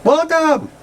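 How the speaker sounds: very high-pitched greeting squeal; mock welcome. Reading a web page's greeting to visitors, high mock welcome.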